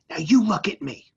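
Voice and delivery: urgent whispered command, mom close up; mom's fierce whisper. Retold or imitated. Mother kneels and orders her son to look at her, whispered. Mom grips son's forearm.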